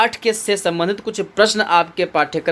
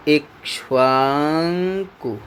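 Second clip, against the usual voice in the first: slow dictation; 3 semitones lower, much slower than usual. Shown as a demonstration. Teacher dictating the answer to students, very slow drawn-out Ikshvaku. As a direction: stretch Ikshvaku out very slowly, low and drawn. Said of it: "इसकी जगह पर क्या लिख दीजिएगा?".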